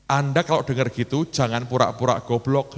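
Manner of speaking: ordinary talk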